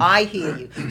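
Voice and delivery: loud, falling acted reply to God; emphatic retold acknowledgement. Speaker recounts answering God after her fall, loud and emphatic.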